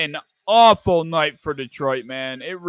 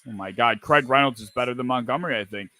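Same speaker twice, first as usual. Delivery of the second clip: normal talking voice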